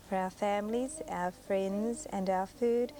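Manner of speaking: slow, unison thank-you grace; group grace. Real, not acted. Teacher leading children in a slow grace. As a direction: say it slowly together like a prayer. Class says grace together.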